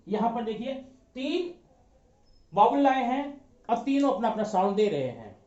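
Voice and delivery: normal talking voice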